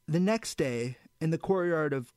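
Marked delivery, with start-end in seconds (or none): none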